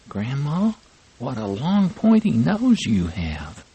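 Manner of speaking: put-on high little girl's voice, puzzled; a child asking grandma, concerned; little girl worried.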